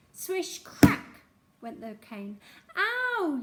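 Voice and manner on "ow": high, loud yelp of pain; small boy yelling ow; boy's yell of pain. Acted. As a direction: cry out as the cane lands. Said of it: "yelled Thwaites".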